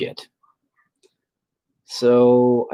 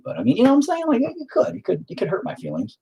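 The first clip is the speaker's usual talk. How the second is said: mock offended; 9 semitones higher, faster than usual. Mock-offended joking aside.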